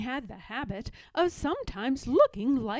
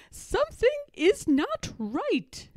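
a higher, worried governess voice; Miss Clavell alarmed; 11 semitones higher than usual. A narrator's reading. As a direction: voice the startled teacher waking in the night. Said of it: "Miss Clavell turned on her light and said".

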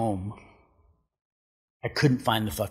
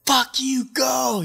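high, loud yell to go; shouted reply; 4 dB louder, slower than usual. Retold or imitated. Noah yelling at him to take the wave, high shout. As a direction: bark him onto the wave.

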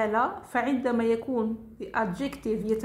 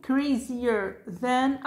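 slow model reading; much slower than usual. Shown as a demonstration. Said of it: "teacher models comparative".

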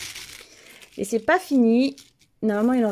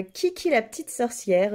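ordinary delivery

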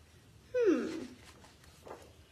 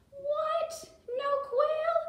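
much higher, playful disbelief; mock shock; 11 semitones higher, 3 dB louder, much slower than usual. Teacher to class, quail gone, mock shock. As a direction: overdo the surprise for the children. Missing birds, mock alarm.

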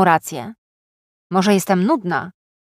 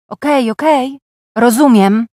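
higher-pitched, exasperated cut-in; irritated; 4 semitones higher, 4 dB louder than usual. A narrator's reading. Woman interrupting a list of suggested hobbies, irritated. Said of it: "powiedziałam z rozdrażnieniem".

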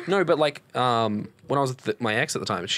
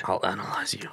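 low whispered threat; mock menacing whisper; 7 semitones lower, 6 dB quieter than usual. Joining the action-hero bit, mock menacing whisper.

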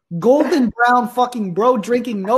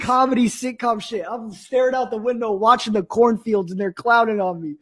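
ordinary talk, nothing marked

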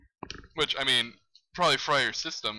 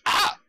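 short, loud "ah!"; sudden loud cry; frustrated yell; 9 dB louder than usual. Real, not acted. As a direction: yelp out in frustration. Startled yell during hard play.

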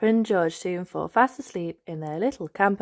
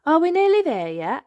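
put-on high, louder impatient little girl; higher, louder are we there yet; Peppa voice; 10 semitones higher, 6 dB louder than usual. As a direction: ask like an eager child on a trip. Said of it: "asks Peppa".

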